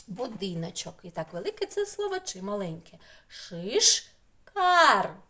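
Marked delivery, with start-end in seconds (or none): 3.3-5.1 "Шишкар" in two loud, separate beats; split into syllables. Shown as a demonstration. Teacher showing children two word parts, loud syllable-by-syllable "Шишкар". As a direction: break "Шишкар" into "Шиш" and "кар", pause between. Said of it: "Так, дві частини".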